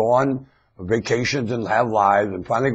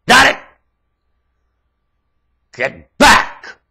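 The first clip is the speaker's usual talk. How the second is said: loud command; 15 dB louder, slower than usual. Real, not acted. Ranter orders the audience back, loud bark.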